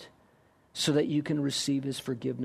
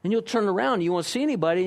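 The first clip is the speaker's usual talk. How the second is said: usual speaking voice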